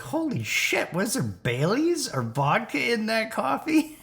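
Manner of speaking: high, startled exclamation; shocked. Retold or imitated. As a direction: cry out high in sudden shock. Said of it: "I was like".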